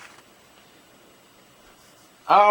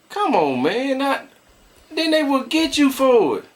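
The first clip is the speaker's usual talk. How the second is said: mock know-it-all; 10 semitones higher than usual. Mocking the know-it-all reply.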